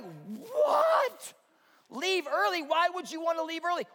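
loud, very high disbelief; stunned fan's high-pitched protest; incredulous shout. Acted. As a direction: yelp it in outraged astonishment. Acted disbelief at friend's request.

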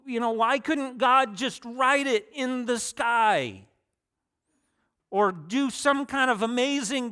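imitating a doubting friend, pleading for proof; friend's challenge. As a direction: push the skeptic's question hard and high. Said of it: "he's told me before".

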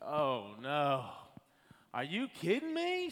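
rising panic, pitch climbing; dismayed disbelief. Retold or imitated. Camera disconnects, panic.

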